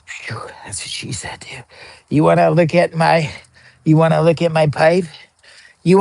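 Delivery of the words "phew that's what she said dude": unvoiced whispered aside; soft conspiratorial whisper. Real, not acted. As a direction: slip the joke out under your breath. Aside joke after tight line.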